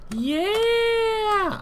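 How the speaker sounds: high, delighted shriek of joy; excited squeal. Real, not acted. Excited about new gear.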